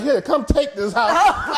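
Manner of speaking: high, slow, laughing through it; mock-offer with a laugh; laughing quote. Mortgage company retold, laughing.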